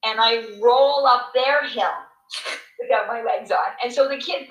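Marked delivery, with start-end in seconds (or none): none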